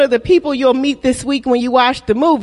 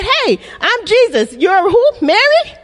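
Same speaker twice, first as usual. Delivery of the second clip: higher-pitched, chatty, rising on the name; mock casual introduction; 5 semitones higher than usual. Preacher imagining Jesus meeting Mary Magdalene, mock casual introduction. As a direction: act out an absurd first meeting.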